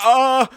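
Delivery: loud, high yelp; reenacted burst of a scream; mock scream. Streamer reenacting the firefight, loud high scream. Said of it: "and I'm like".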